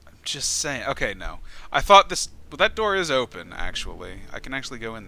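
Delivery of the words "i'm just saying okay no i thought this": normal talking voice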